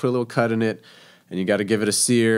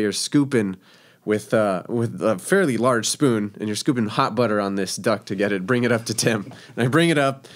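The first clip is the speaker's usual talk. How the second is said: ordinary delivery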